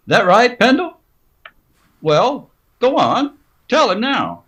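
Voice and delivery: anxious urging. Acted. Sam urging Pendle to confirm the alibi to the marshal, anxious. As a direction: push him to back up the story.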